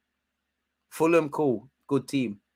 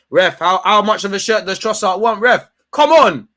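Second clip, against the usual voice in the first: much louder, higher furious protest; angry yell at ref; 6 semitones higher, 11 dB louder than usual. Real, not acted. Fan raging at the ref over a shirt pull, angry shout. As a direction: scream it at the referee.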